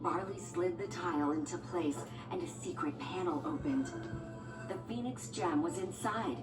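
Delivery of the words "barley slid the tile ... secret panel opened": usual speaking voice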